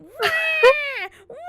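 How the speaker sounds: loud, high imitated cat yowl; screaming cat. Owner imitates her cat complaining in the car, screaming. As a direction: wail at full volume like a furious cat. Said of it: "started screaming in my face".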